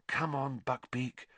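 soft murmur to the animal. Acted. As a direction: coax him under your breath. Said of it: "Harry murmured".

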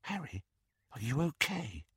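dropped to a soft hush. A narrator's reading. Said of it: "She dropped her voice".

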